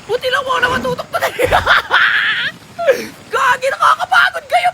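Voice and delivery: high-pitched pleading squeal; breathless-sounding high whine of alarm; frantic panic. Real, not acted. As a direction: urge yourself on in a high, panicky voice. Frantic escape from pursuers.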